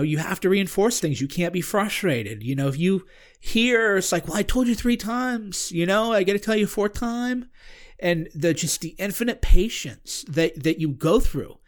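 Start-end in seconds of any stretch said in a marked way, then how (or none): 4.3-7.5 higher, impatient mock teacher voice; mock exasperated. Parent complaining about repeating themselves, mock exasperated. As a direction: sigh it out like you've said it too often.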